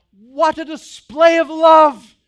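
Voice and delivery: loud, slow, laughing sarcastic cry; hammed-up shout of thanks to dad; mock grateful yell. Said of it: "they do not yell".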